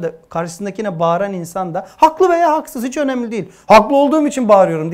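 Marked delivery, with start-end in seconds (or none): 2.0-4.9 high, loud mock yell of someone who claims he is right; mock shouting quarrel. Preacher voicing a man who shouts because he thinks he is right, loud mock yell. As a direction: bark it out like a self-righteous arguer. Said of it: "diyemezsin ki".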